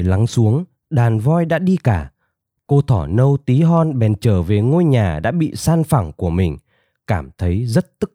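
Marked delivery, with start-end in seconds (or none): none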